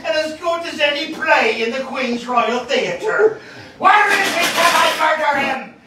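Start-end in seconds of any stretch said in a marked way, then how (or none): 3.8-5.7 higher, louder murderous bellow; shouting like a stuck pig. Acted. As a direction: roar for blood. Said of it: "he shouting like a stuck pig".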